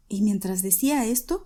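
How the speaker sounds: ordinary delivery, nothing marked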